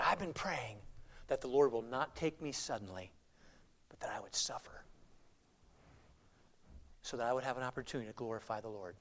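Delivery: low, very soft reverent voice; hushed, solemn prayer. Retold or imitated. Well-known preacher telling of his prayer to suffer, hushed solemnity. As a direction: let it sink to near a murmur, with long pauses.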